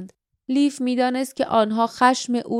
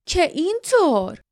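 higher, mock-surprised sneer; 6 semitones higher than usual. A narrator's reading. Guardian disbelieving Lif, sneering. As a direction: sneer the question in doubt. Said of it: "محافظ پوزخند زد".

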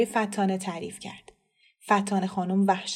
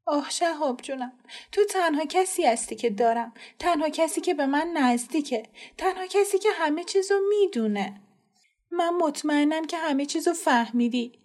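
higher, tearful confiding plea; cling to the brother and beg to be believed; pleading girl; 6 semitones higher than usual. Acted.

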